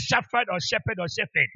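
"shepherd" three ways: SHAF-ad, SHEP-ed, SHEF-ed; contrasting pronunciations. Shown as a demonstration. Preacher quizzing the congregation, "shepherd" as SHAF-ad, SHEP-ed, SHEF-ed. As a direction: offer each "shepherd" distinctly, changing the middle consonant and vowels. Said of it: "how should I say?".

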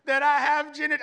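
put-on high, loud, slow childlike voice; mock the kid's earnest report; dad imitating daughter's earnest claim; earnest little girl. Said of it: "She said".

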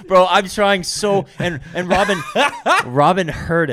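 high, loud, slow words through laughter; real helpless laughter breaking up the words; laughing hard. Real, not acted. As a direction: struggle to get the story out while cracking up. Cracking up while telling story.

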